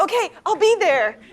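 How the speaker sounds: high, loud parting shout; hasty call back. Acted. New hire rushing off from the editor, hurried loud reply. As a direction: toss it loudly over your shoulder.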